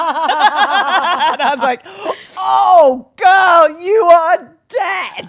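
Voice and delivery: high, loud, slow shout through laughter; laughing shout. Real, not acted. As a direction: yell it while cracking up.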